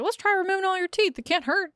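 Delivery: high-pitched, breezy mimicry of a clueless doctor; mock doctor. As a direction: toss out a terrible idea in a high mocking voice.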